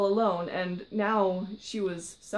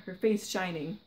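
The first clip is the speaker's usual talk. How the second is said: ordinary delivery, nothing marked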